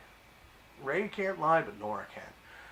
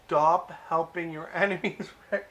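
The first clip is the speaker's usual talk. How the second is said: higher, louder, laughing plea to the character; laughing exasperation; 6 semitones higher, 7 dB louder than usual. Real, not acted. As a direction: scold him through a laugh.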